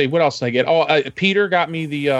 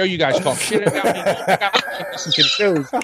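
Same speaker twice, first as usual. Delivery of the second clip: laughing; 4 semitones higher than usual. Real, not acted. Laughing complaint to co-hosts.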